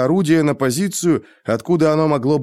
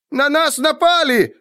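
high, slow, squealing rat cry; skaven shriek; slower than usual. A narrator's reading. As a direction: shriek it like a panicked rat-man. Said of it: "услышал он крик Сквик-Сквика".

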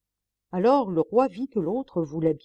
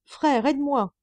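high, loud cry for help from the torrent; calling for help; 8 semitones higher, 5 dB louder than usual. A narrator's reading. Monk in the flood calls to his brother, loud cry for help. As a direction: shout to the brother as the water rises. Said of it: "Il appela".

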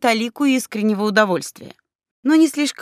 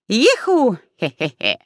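villain laugh; slower than usual. Pretend. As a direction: cackle like a cartoon villain. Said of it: "репетируем злодейский смех". (злодейский смех)

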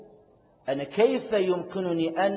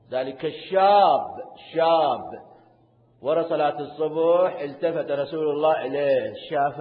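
loud slow storytelling; 8 dB louder than usual. Acted. Storytelling emphasis.

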